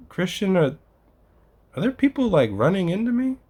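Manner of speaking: high, puzzled voice climbing up; confused dad. Retold or imitated. Imitating his dad on a visit, high baffled question. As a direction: sound baffled and a bit alarmed. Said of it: "he was like".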